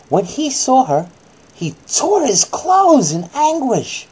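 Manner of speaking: slow, high, loud bedtime-story reading; hammed-up children's Bible story voice; dramatic storytelling. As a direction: read it like a big dramatic bedtime tale. Dramatic narration.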